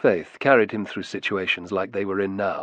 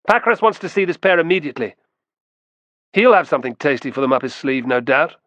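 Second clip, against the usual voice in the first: raised, fast officer's voice; officer's announcement; 5 semitones higher, 5 dB louder, faster than usual. A narrator's reading. Optio in charge to the soldiers surrounding the pair, officer's announcement. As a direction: announce it loudly and quickly, starting high.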